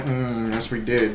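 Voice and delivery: normal talking voice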